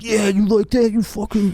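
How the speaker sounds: high-pitched in-your-face mock taunt; taunting linebacker. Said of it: "I'd be like".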